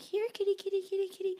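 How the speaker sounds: high, sing-song call to a stray cat; calling a kitty. Retold or imitated. Calling into the dark roadside ditch for a lost kitten, high coaxing call. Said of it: "and I'm like".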